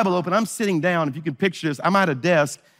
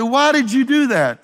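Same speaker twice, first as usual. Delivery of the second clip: shocked; 7 semitones higher, 3 dB louder than usual. Retold or imitated. Shocked after being slapped.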